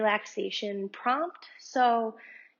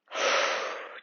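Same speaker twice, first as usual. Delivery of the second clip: audible sigh. Shown as a demonstration. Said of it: "take a big breath out".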